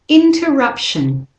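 a clear teacher model of "interruption"; model pronunciation. Shown as a demonstration. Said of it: "Say the words with me".

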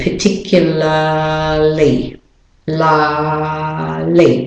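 slow non-rhotic "particularly", long "lah" and no R; British and Australian accent. Shown as a demonstration. Teacher explains the silent R, British-Australian "particularly" drawn out. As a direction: stretch "la" in British-Australian style, R dropped. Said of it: "the R is not said".

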